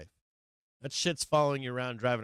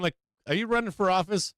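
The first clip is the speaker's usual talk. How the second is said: higher, raised-voice grilling; ranting interrogation; 8 semitones higher, 5 dB louder than usual. Acted. Streamer grilling internet complainers, ranting interrogation. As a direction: fire off loud rhetorical questions. Mock interrogation of online critics.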